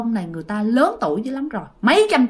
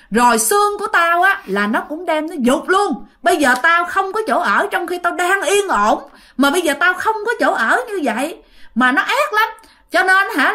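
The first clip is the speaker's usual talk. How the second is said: higher-pitched, aggrieved ghost tirade; heated retold rant, voice pitched up; angry spirit; 6 semitones higher than usual. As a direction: act out a vengeful spirit's accusation. Possessing spirit accuses and threatens.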